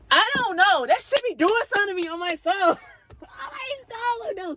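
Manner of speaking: high-pitched overwhelmed squeal; excited squeal. Real, not acted. Storyteller squealing to viewers about the hookup. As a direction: squeal it out, laughing in disbelief.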